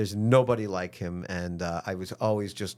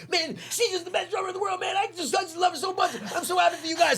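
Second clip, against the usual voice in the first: much higher, fast, choked-up gushing; a rock star overcome with tearful love for the drummer; faster than usual. Retold or imitated. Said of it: "No, he's crying".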